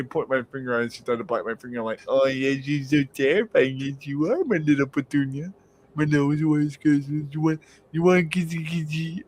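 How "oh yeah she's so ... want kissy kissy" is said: higher cutesy teasing voice; retold patronising kissy talk; mock baby talk. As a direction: coo as if to a tiny pet. Baby-talking to tease girlfriend.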